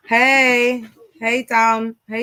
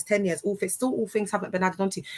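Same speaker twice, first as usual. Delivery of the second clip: ordinary delivery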